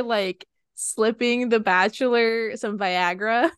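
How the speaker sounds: ordinary delivery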